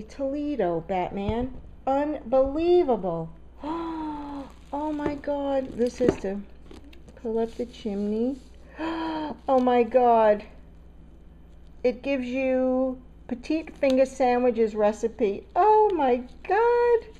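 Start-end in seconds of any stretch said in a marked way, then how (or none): 3.6-4.4 sharp, high intake of amazement; amazed gasp. Real, not acted. Astonished at gift box. 8.8-9.4 short, high, soft gasp; amazed gasp. Real, not acted. Astonished at gift box. 15.6-17.1 high, loud, drawn-out amazement; real, loud astonishment; astonished. Real, not acted. Astonished by gift's contents.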